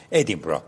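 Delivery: ادینبرا with stress on the first syllable, the end dropping low; stress not on the end. Shown as a demonstration. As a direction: put the weight on ادی in ادینبرا, let -برا fall away. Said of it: "حتی استرسش هم باید آخر نگید".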